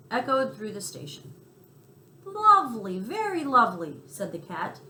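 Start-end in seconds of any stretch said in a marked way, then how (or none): 2.2-4.0 higher, louder admiring purr of praise; delighted praise. A narrator's reading. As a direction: savour it like a delighted cat. Cat praising loudly.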